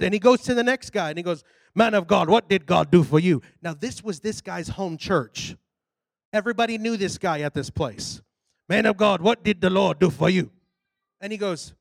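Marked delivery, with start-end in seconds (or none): 1.7-3.4 lower, imitated African preacher voice; African pastor. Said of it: "Big old African guy". 8.7-10.5 African pastor. Retold or imitated. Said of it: "Big old African guy".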